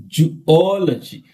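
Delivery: "Zoology" slowed, with a long stressed second O. Shown as a demonstration. Teacher showing real pronunciation, stressed second syllable. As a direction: stretch the middle O of "Zoology". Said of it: "that is a real pronunciation".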